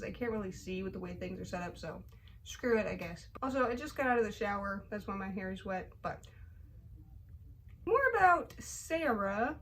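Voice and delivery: normal talking voice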